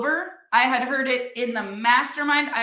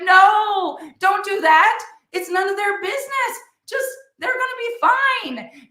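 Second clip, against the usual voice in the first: much higher, louder panicky "No"; alarmed high refusal; 8 semitones higher, 3 dB louder than usual. Retold or imitated. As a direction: imitate a flustered guy waving it off. Christopher refusing the video.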